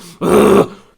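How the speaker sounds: high, loud rising gasp; swooning gasp. Real, not acted. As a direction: gasp like you're lovestruck.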